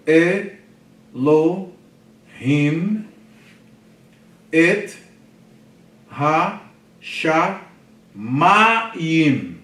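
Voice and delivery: each syllable projected on its own. Shown as a demonstration. Teacher showing class how to read Hebrew, syllable by syllable. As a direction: read out isolated syllables, pausing after each. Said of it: "a segmentação em sílabas".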